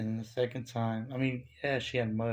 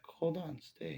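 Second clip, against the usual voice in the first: higher-pitched plea, imitated; urging to stay; 5 semitones higher, 3 dB quieter, slower than usual. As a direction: imitate someone quickly stopping a guest from leaving. Woman urging him not to leave.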